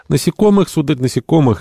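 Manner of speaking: irritated, high-pitched retort; angry. A narrator's reading. Cousin Benedict answers the captain about his search, angrily. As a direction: snap back, pitched well up. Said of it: "сердито ответил кузен Бенедикт".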